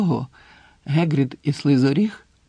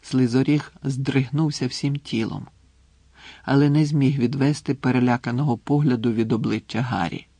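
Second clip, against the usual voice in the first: plain reading voice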